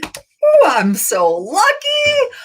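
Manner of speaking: very high, drawn-out squeal of glee; hammed-up thrilled morning voice; acted delight. Acted waking up delighted with body.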